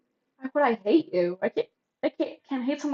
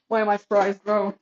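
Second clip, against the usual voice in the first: ordinary talk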